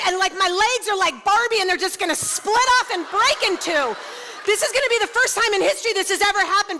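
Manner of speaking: a frantic woman begging mid-labor; panicked plea. Retold or imitated. Mother in labor pleading with the midwife, frantic.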